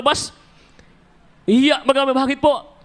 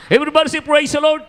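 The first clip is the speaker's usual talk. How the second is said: rallying call; 6 semitones higher than usual. Real, not acted. Preacher leading congregation call.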